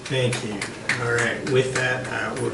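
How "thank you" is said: normal talking voice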